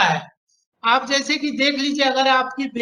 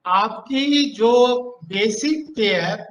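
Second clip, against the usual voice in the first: slow; 2 semitones lower, slower than usual. Real, not acted. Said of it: "फिर से बोलिए तो सर।".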